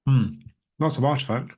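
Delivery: finishing a mouthful mid-sentence; crunching food. Real, not acted. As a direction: still chewing dates while talking. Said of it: "if you can hear me crunching away here".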